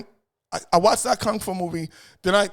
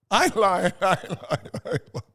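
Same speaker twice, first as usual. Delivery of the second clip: laughing. Real, not acted. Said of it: "insists while laughing".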